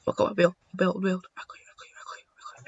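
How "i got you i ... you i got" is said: voiceless whispered chant; rapid whisper. Real, not acted. Player chasing an opponent, whispering intently. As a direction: whisper it over and over under the breath.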